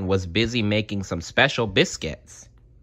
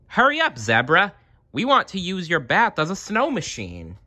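eager; 9 semitones higher than usual. Acted. Little Bear eager for bubbles.

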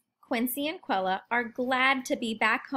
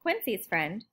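plain reading voice

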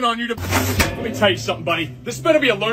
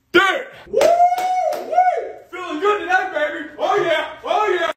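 celebrating; 11 semitones higher, slower than usual. Acted. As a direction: whoop for joy at the good news. Dad celebrating son's date.

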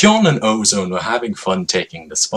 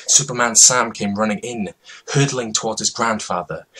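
ordinary delivery

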